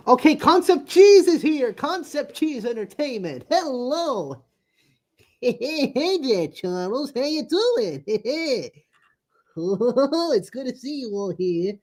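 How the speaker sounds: high giggly cartoon voice; squeaky high voice. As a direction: greet them in a squeaky voice. Greets viewer in squeaky voice.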